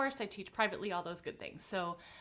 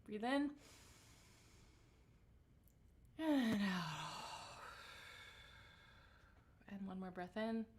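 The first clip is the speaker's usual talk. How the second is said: calm grounding exercise. Acted. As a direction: guide the breath gently. Leads breathing warm-up.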